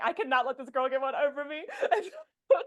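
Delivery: laughing. Real, not acted. Laughing while retelling.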